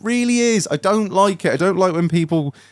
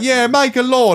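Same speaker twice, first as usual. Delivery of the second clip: high, eager mock cheer; mock eager crowd; 11 semitones higher than usual. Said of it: "we're like".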